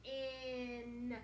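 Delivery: slow stretched phonics sound. Shown as a demonstration. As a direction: say the sound on its own, slowly. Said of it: "Can you figure out this word now".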